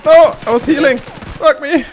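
loud high anguished shout; frustrated yell. Real, not acted. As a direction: howl in frustration. Dies while healing in game.

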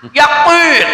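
"Yakin" loud and high, its k said as Arabic qof; emphatic qof pronunciation. Shown as a demonstration. Preacher repeats the lesson for listeners, emphatic "Yakin" with qof. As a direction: stress "Yakin" with a back-of-throat q for k. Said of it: "Pakai kof, bukan pakai ka".

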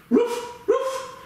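very high playful mock barks; dog barking. Yoga teacher to children in downward dog, high playful barking. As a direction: yap like a small excited puppy. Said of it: "what does the dog say?".

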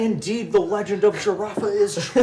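high, loud awestruck gush; excited gushing. Acted. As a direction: gush like a starstruck fan. NPC gushing over a cat lady.

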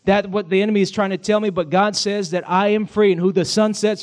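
loud, high, rising preacher's cry; preaching at full force. Acted. Pastor crying out to the church that God makes a way, preaching at full force. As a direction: drive it home to the congregation.

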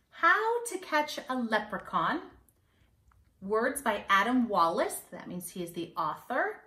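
ordinary delivery, nothing marked

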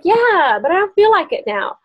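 high, breezy brush-off; lightly voiced friend, unbothered; friend's shrug. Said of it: "she was like".

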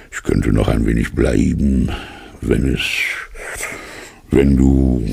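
lightly voiced low bear, trailing off; hesitant bear. A narrator's reading. Bear hints he could stay, hesitant. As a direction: break off hopefully between pauses. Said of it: "sagte Puh".

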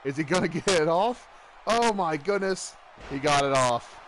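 excited play-by-play call; wrestling commentator. Acted. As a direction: call the match like ringside. Play-by-play of the match.

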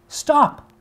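very high, loud command; shout. Acted. Apollo yelling at fleeing Daphne, loud. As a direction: yell after her. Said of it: "Apollo cried".

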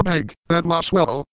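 ordinary delivery

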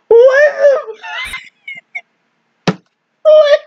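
high stunned shouting; shocked laughter. Real, not acted. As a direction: shout it out in disbelief. Shocked reaction to girl.

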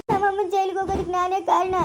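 crying confession of guilt; tearful self-blame. Acted. Daughter saying she caused mother's jailing, crying. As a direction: insist through tears that you are to blame. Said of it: "ಇದ್ಯಾಕೆ ಚೈತನ್ಯ ಹಿಂಗ್ ಅಳ್ತಾವಳೆ".

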